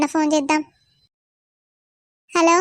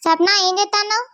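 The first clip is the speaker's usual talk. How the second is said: calling out; 3 semitones higher, faster than usual. Acted. Mother calling daughter from afar.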